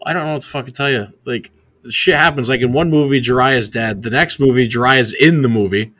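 worked-up, loud confusion; exasperated explaining. Real, not acted. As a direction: raise your voice over a plot that makes no sense. Confused, arguing over movie plots.

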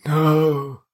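mock despair. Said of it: "he just goes".

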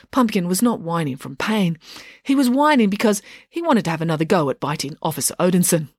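usual speaking voice

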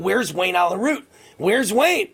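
high-pitched mild mock yell; retold scream. Host retells the president searching mid-speech, mild mock scream. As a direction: imitate calling out for someone, half-hearted scream. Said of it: "he's screaming".

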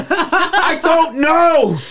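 high, drawn-out mock wail; tearless wailing. Telling dad he can't cry, mock tearless wail. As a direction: wail it as if trying to cry and failing. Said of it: "Tears won't come down".

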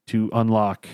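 ordinary talk, nothing marked

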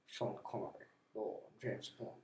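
low, soft Scottish impression; hushed, low screen-spy voice; Sean Connery. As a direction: murmur it quietly in a Scottish actor's voice. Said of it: "or my best Sean Connery".

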